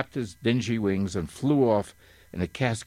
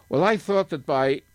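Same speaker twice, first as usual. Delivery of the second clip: higher-pitched, mildly desperate attempt; 8 semitones higher, 4 dB louder than usual. A narrator's reading. Milo trying once more to reason with the bird, mildly desperate. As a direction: plead a little desperately. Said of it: "he tried again desperately".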